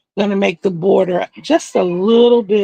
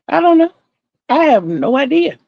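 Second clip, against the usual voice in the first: exclaiming; 7 semitones higher, 4 dB louder than usual. Real, not acted. Exclaiming no idea.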